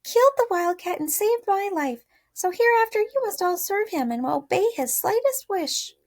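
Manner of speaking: high queenly mouse. A narrator's reading. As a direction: proclaim it like a tiny queen. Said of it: "she answered".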